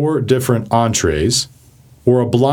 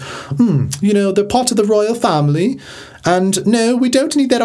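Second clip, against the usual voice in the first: high, hammed-up English royal accent; 10 semitones higher than usual. Persnickety member of the royal family, mock English accent. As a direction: put on a fussy, pitched-up English accent. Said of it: "they almost always have an English accent".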